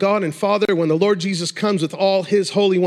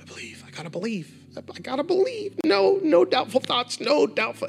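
high, tense, forced self-convincing; mocking strained believer; 8 semitones higher than usual. Mocking believers who strive against doubt, strained. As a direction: parody someone straining to believe. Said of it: "this mentality of".

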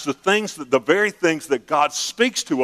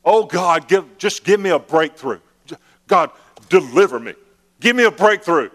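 higher, louder begging for a breakthrough; mock pleading prayer; 4 semitones higher, 4 dB louder than usual. Preacher mimicking a believer begging loudly. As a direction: beg God like a spoiled child.

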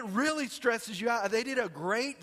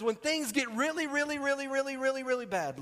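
"really" repeated in one high, even run; emphatic repetition; 5 semitones higher than usual. Acted. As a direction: hold the pitch up through every "really", then drop on "bad". Piling up repeated word for effect.

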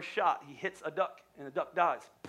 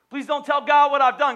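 loud desperate plea; 7 semitones higher, 11 dB louder than usual. Retold or imitated. Sinner begging God not to know, loud desperate plea. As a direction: call the plea out loud and high.

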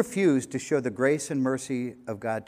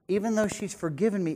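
ordinary talk, nothing marked

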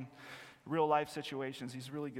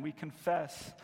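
ordinary talk, nothing marked

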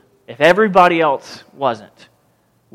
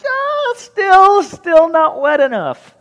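high, slow and loud, savoring it; mock-fussy Elijah; 11 semitones higher, 5 dB louder, slower than usual. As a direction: tease them that it's still too dry. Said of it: "He's like".

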